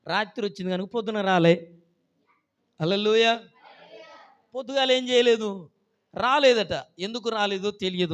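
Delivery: loud, high, rising sermon fervour; preaching at full force. Acted. Preacher telling the church about the widow, in fervent full voice. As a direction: drive the story home to the congregation.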